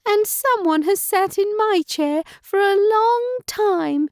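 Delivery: put-on baby bear whining about his chair, squeaky and high. A narrator's reading. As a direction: squeak it like a tiny bear cub. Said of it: "squeaked Baby Bear in his high-pitched voice".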